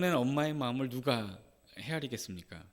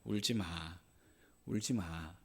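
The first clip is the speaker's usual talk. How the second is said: soft consoling; 10 semitones lower, 8 dB quieter, slower than usual. Retold or imitated. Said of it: "여인을 위로하면서".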